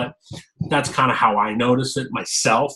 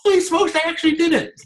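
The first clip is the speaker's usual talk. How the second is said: much higher, thrilled disbelief; amazed; faster than usual. Retold or imitated. Telling a friend about finally appearing in a video, amazed. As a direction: gasp it out like you can't believe it. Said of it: "I'm like".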